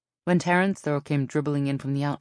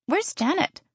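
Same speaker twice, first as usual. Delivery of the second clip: high-pitched, pointed question; questioning sister; 3 dB louder than usual. A narrator's reading. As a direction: ask it high, expecting an answer. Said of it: "Zoe asked".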